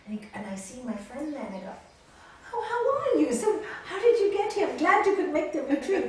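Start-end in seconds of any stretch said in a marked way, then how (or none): 2.5-6.0 high, loud, gushing welcome; delighted greeting. Retold or imitated. [name] greets her friend at the retreat, delighted. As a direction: greet a friend in happy surprise.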